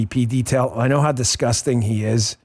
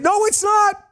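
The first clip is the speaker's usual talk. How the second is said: high-pitched mocking voice, pitched way up; mock denial. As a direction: squeak out the denial like a mock figure.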